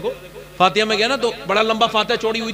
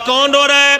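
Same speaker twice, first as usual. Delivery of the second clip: raised voice hailing someone inside; calling out; 7 semitones higher, 8 dB louder than usual. Acted. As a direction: call through a closed door. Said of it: "دروازے پہ آواز دی".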